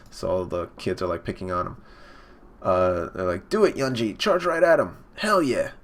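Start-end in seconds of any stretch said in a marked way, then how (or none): 3.5-5.7 high-pitched mock cheering; imitated rowdy encouragement; egging on. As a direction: cheer like kids at a fight. Kids egging on a brother.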